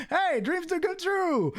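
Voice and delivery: very high, drawn-out exclamation; excited cheer. Real, not acted. Host celebrates a lucky winner, excited cheer. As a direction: crow it high and slow in delight.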